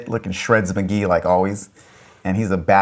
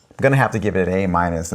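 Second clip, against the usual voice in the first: ordinary delivery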